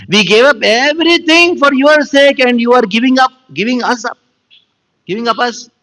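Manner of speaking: high-pitched, impassioned complaint; acted aggrieved protest. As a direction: cry out the injustice as the wronged devotees. Acts gopis' aggrieved protest.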